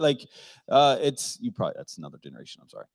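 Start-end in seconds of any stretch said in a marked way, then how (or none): none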